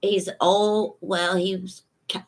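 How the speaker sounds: ordinary delivery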